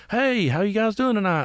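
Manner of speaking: high-pitched stage hello; drummer greeting crowd. Retold or imitated. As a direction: mimic a drummer hailing the bar crowd.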